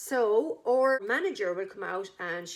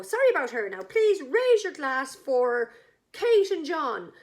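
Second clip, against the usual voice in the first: louder, slow announcement; mock formal toast; 5 semitones higher, 7 dB louder than usual. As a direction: push on with the toast, brushing off the fall. Manageress resumes toast.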